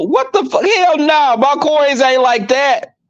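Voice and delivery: high-pitched "what the fuck", then a slow "my coins"; outraged disbelief. Real, not acted. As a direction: balk at the cost of yet another trip. Outraged reaction to trip cost.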